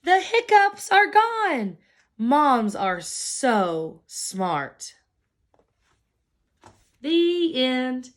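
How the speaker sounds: plain reading voice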